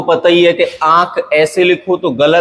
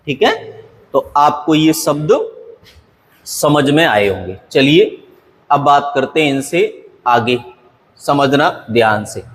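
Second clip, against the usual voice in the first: normal talking voice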